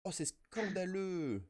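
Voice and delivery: slow high hammed-up indignation; mock outrage. Host mock-outraged at the frame, slow high indignation. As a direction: play outraged, stretched out.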